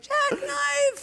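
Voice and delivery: very high falsetto yell; mock gleeful shout. Oblivious bachelor jumping in while she cries, hammed-up joyous shriek. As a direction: whoop it like a diver leaping. Bachelor diving back into pool party.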